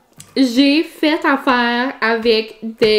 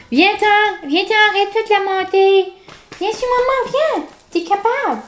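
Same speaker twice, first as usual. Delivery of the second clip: doggy mom voice; 8 semitones higher than usual. Real, not acted. Calling her dog onto her lap, high coaxing voice. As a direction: coax the puppy up in a high sing-song.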